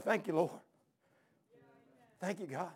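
soft, hushed prayer of thanks; understated, moved thanksgiving; retold tearful thanks. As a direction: recall a tearful prayer, softly, with long pauses. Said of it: "Man, I started crying".

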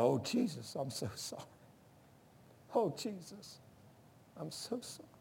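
retold tearful plea, fading very soft; imitated crying. Preacher retelling a prisoner's plea to Jesus after falling, imitated crying. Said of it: "and he was crying".

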